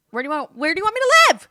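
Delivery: high, loud, fast screamed question; furious shout. Retold or imitated. Daughter screaming at her mother about where to live, furious shout. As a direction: yell it at her in exasperation.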